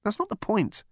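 flustered man protesting; protesting Arthur. A narrator's reading. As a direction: push back, flustered. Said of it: "Arthur protested".

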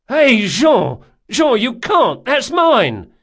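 very high, loud protest; alarmed shout. A narrator's reading. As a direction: yell out to stop your friend. Shouts as his purse is taken.

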